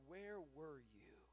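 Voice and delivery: slow, low, hushed reproach; quiet accusation. Retold or imitated. Martha to Jesus on his absence, quiet accusation. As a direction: accuse softly, drawing out each word.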